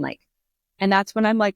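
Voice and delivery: usual speaking voice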